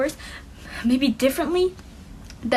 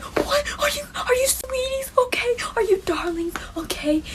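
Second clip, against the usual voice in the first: much higher, breathy, cooing nurse; sweet nurse; 11 semitones higher than usual. Retold or imitated. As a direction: coo it like a doting school nurse. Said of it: "And the nurse is like".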